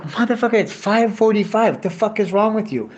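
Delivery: high-pitched exasperation, not shouted; acted indignant protest. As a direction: protest like a fed-up worker. Acted worker protests late order.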